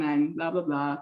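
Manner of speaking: ordinary talk, nothing marked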